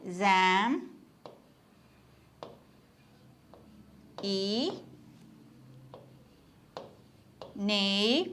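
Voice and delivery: "exam-, ഈ, നെയ്" as isolated drawn-out syllables, long pauses between. Shown as a demonstration. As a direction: sound out each syllable slowly while writing. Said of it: "transcribe ചെയ്യുമ്പോ എങ്ങനെയാണ്".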